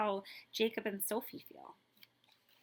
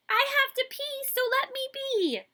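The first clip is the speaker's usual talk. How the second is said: put-on high, loud childish chant; sing-song it like a cheeky kid; child's voice; 9 dB louder than usual. Child reciting a funny rule.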